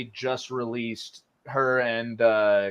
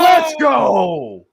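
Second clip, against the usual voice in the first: loud, high victory yell; triumphant shout; 11 semitones higher, 11 dB louder than usual. Real, not acted. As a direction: celebrate the win at full volume. Guessed right for nine points.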